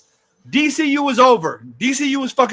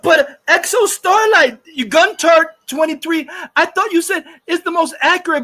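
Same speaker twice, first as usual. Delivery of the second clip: furious rant; 4 semitones higher than usual. Real, not acted. Shouting back at a viewer in the chat, furious. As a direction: yell it at the chat, outraged. Ranting at a commenter.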